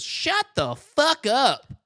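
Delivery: high, loud, drawn-out yell; Turkey Feather. Acted. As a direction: interrupt with a squeaky cartoon kid shout. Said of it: "Turkey Feather goes".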